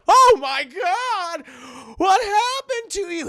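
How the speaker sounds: loud, high cry of disbelief; mock shocked yell. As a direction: act out horrified shock, drawn out and loud. John shocked on seeing Chucky.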